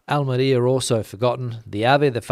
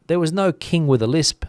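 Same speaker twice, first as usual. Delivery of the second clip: normal talking voice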